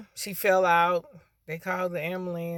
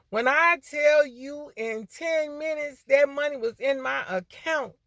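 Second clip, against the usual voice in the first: excited testimony; 7 semitones higher, 3 dB louder than usual. Real, not acted. Testifying the loan came through.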